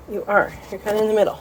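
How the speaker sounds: normal talking voice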